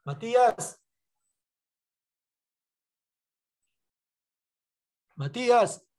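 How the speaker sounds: loud, high call of a name. Real, not acted. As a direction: call the name across a room. Calling pupil who doesn't answer.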